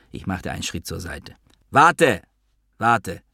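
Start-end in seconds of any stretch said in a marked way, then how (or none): 1.7-2.2 high, raised call to wait; urgent call. A narrator's reading. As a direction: stop someone walking away. Calls after the departing narrator. (dringender, hoher Zuruf)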